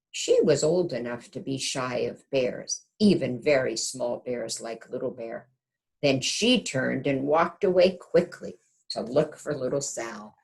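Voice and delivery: gentle storybook narration; reading to children. A narrator's reading. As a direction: read it like a bedtime picture book. Reads picture book aloud.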